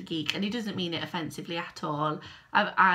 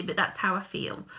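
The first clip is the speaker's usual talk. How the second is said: usual speaking voice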